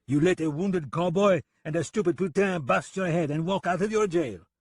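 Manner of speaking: put-on high, taunting French-accented jibe. French gunman mocks deputy over the jailbreak, taunting. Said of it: "He grinned tauntingly".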